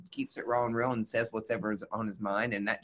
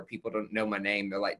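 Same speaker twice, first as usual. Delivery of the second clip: normal talking voice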